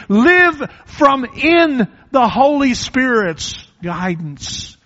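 high, loud, slow exhortation; loud slow preacher's charge; preaching at full force. Acted. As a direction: call them to live it, loud. Exhortation to the congregation.

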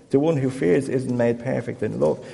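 ordinary talk

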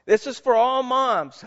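sermon tribute read with lifted voice. Acted. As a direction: proclaim it to every mother in the room. Reads Mother's Day tribute.

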